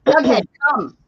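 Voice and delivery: loud, commanding mom voice. Real, not acted. Owner ordering Jughead to come, in a loud mom voice. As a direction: call out firmly like a mom who means it. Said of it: "I'll use my mom voice".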